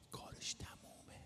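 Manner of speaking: a breathless whisper to himself; whispered thought. Retold or imitated. Preacher whispering his own grim thought. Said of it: "با خودم فکر کردم".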